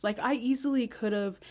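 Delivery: ordinary talk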